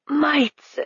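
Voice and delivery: gasped out, high and strained; gasping as if paralyzed. A narrator's reading. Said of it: "he gasped".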